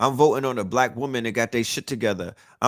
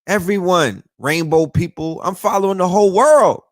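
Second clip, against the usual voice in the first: rising louder and higher to a near shout; excited build; 5 semitones higher, 8 dB louder than usual. Real, not acted. A podcaster hyping his wide social media feed, building to a shout. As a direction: build it up till you're almost yelling the last line.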